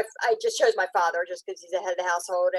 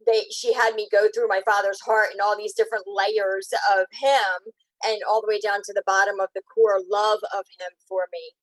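ordinary delivery

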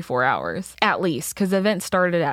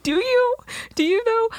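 very high doubtful squeak; high-pitched mock scepticism; sceptical challenge; 13 semitones higher than usual. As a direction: question it in a sky-high voice. Retold doubt of drunk driver.

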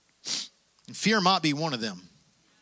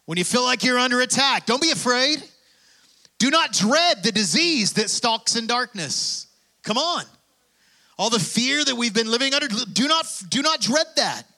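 preaching at full force; 5 semitones higher than usual. Acted. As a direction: drive it home to the congregation, pitch up. Preaching to the congregation.

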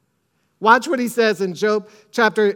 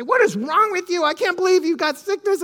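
high, scolding mock rebuke; mock condemning; 7 semitones higher than usual. Mock scolding the sick little daughter. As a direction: play the judgmental parent. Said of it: "I'm not there to condemn and say".

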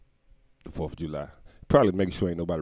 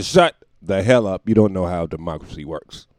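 telling off; 8 dB louder than usual. Acted. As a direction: bark it at the critics. Telling critics off.

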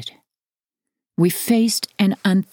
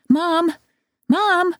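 high-pitched urgent call for mom; a child calling out for his mother, pitched way up; yelled; 13 semitones higher than usual. A narrator's reading. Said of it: "he yelled".

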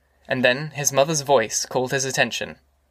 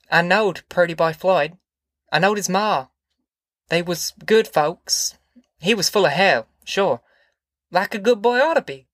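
higher-pitched country mother, a touch flustered; confused mother; 4 semitones higher than usual. Acted. Ma explaining to Tom about Floyd, in confusion. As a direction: reminisce in a raised voice, in short halting phrases.

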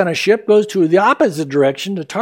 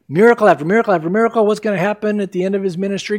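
ordinary talk, nothing marked

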